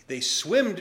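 ordinary delivery

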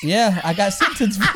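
laughing through the words; chuckling while admitting it; amused confession with laughter. Real, not acted.

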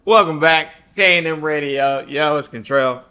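loud, high hype intro; radio host intro. Acted. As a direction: welcome listeners back with energy. Radio host reopening the show.

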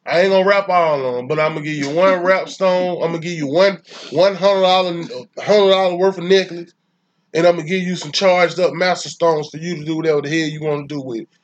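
ordinary talk, nothing marked

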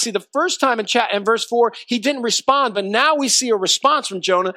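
ordinary talk, nothing marked

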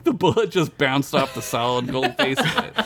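usual speaking voice

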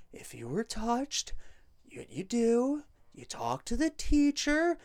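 hushed, calm voice explaining to kindergartners; Comey impression. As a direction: talk like soothing small children, hushed. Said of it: "talks like he's giving a demonstration to kindergartners".

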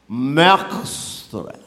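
slow, loud, drawn-out pompous scholar; self-important doctor, heavily drawled. Acted. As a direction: stretch every word grandly like a learned doctor. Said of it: "Já viu um doutor assim?".

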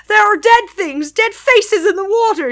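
high, loud cry of horror; shocked, high-pitched horror. A narrator's reading. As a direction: recoil from what's in the water. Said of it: "he said with horror".

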